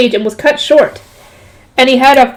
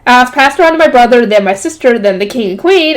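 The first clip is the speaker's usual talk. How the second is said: usual speaking voice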